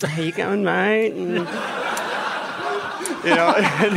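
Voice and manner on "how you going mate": high Aussie greeting; quick Australian impression; Aussie accent. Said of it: "full-on Aki impersonation".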